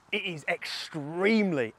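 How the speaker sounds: "extremely" stretched out slowly and weighted; stress on extremely. Shown as a demonstration. To viewers about the autumn colours, leaning hard on "extremely". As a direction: lean hard on "extremely", taking your time. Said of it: "emphasis on the word extremely".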